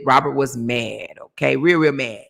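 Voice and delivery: usual speaking voice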